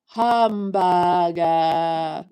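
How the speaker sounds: slow, drawn-out mock Japanese hamburger; mock Japanese accent. Streamer quizzing chat on a fake Japanese word, slow mock accent. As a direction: stretch hamburger slowly as hahm-bah-gah. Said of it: "What English word did I just say".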